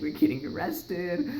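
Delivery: half laugh the panic out. Retold or imitated. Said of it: "We're like".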